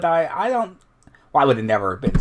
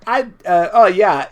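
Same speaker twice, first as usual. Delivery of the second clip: normal talking voice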